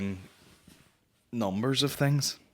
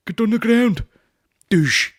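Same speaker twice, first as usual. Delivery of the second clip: much higher, loud barked command; mock shout; 13 semitones higher, 10 dB louder than usual. To listeners, playing the man tackling his attacker, a loud mock shout. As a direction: bellow it like tackling an intruder. Acting out a violent home intruder tackle.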